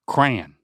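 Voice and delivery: "crayon" run into one beat, rhyming with man; one-syllable crayon. Shown as a demonstration. Repeating it for Dad, "crayon" as one syllable like man. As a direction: say "crayon" like "cran", falling. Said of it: "Rhymes with man".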